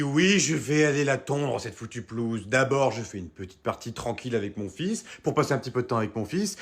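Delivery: ordinary talk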